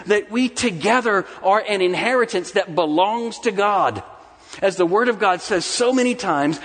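high, ringing pulpit delivery; fervent sermon climax; impassioned preaching. Real, not acted. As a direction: preach it in high, rolling phrases, building the list.

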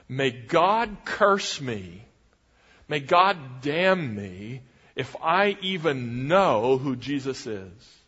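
slow, deliberate oath on oneself; solemn curse. Acted. Peter calling God's curse on himself, solemn oath. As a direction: weigh each word as a vow before God. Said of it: "a curse before God".

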